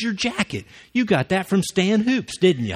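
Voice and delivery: high-pitched, aggravated accusation. Retold or imitated. Area manager accuses the speaker over the jacket, aggravated. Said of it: "he was aggravated".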